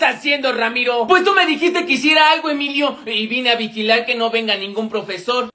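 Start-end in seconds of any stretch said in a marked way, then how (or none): none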